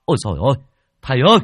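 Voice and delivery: yelling out. Acted. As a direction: scream to the master from the bushes. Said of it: "một người hét to". (hét to hoảng hốt)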